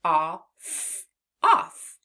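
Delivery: "off" rising to an excited peak; emphasised word off. Shown as a demonstration. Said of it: "the beginning of the word off".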